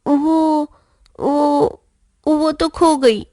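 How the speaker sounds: sheepish stammer. A narrator's reading. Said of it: "Pappu सिट पिटाकर".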